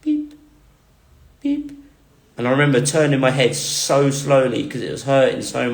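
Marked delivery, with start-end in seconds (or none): 0.0-1.7 high, soft electronic beep; short high beeps at the bedside; imitated monitor beep. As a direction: mimic a life support machine beeping. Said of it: "I just hear this beeping".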